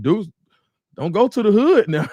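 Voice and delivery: high and loud teasing voice; mock viewer voice. Reading a viewer's comment aloud in a high teasing scold. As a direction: pitch it up and scold him playfully. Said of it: "Aretha Burton said".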